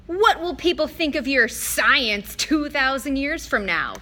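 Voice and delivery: god mocking mortal science, slow and loud; scornful. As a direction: lean on the scorn. Mister D mocks science.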